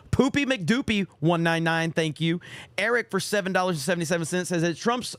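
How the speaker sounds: usual speaking voice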